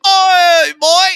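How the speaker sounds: very high, loud mock Australian "Oi, boy!"; Australian accent. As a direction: ham up an Aussie bloke hollering. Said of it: "they'd be like".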